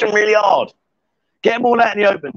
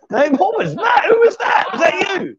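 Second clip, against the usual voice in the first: surprised calling out; 11 semitones higher than usual. Real, not acted. Hunting who shrieked.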